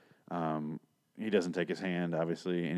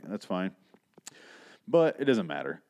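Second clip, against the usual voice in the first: ordinary talk, nothing marked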